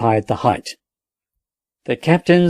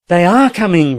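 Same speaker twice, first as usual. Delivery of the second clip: louder, higher joyful cry; 6 semitones higher, 4 dB louder than usual. A narrator's reading. Captain echoing the sergeant, crying out with joy. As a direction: burst out in relief. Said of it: "repeated the captain with a cry of joy".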